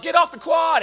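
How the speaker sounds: high, loud imitated holler; yelling out. Folks hollering at freshmen on the quad, mock yell. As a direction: yell it like an upperclassman across the lawn. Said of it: "folks that would yell out".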